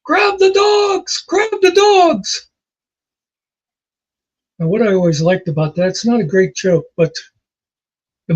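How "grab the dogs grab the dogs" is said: high, drawn-out falsetto yell; yelling on the way down, very high-pitched and slow; mock falling yell. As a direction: stretch it out high like someone yelling while falling. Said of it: "he's yelling".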